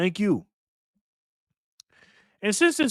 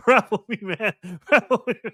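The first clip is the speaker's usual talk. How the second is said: E-40 voice; 4 semitones higher than usual. Retold or imitated. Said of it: "in my E forty voice".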